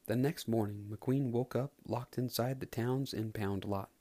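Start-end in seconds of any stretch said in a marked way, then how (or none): none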